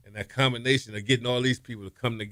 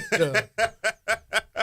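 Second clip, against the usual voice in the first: surprised coach; 11 semitones higher than usual. Retold or imitated. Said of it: "coach was like".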